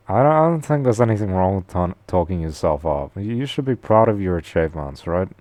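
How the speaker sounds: normal talking voice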